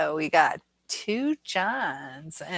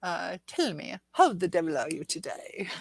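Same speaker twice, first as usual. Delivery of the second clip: hammed-up British old-chap greeting; British accent. Shown as a demonstration. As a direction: greet them in mock British. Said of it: "British accent mine is".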